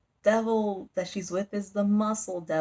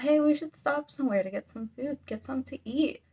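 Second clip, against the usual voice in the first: lightly voiced higher chatter; casual suggestion in a raised voice; chatting characters; 7 semitones higher than usual. Travellers talking about getting some food, lightly acted in a higher voice.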